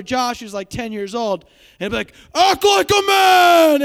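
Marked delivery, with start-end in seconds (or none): 2.3-3.8 high, very loud, drawn-out yell; dad's mock shout. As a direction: imitate dad bellowing a movie line. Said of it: "my dad sometimes will go up".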